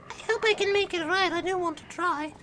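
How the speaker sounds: squeaky mouse. Acted.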